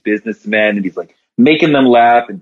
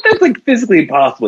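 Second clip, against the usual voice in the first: scoffing bartender; 8 semitones higher, much faster than usual. Acted.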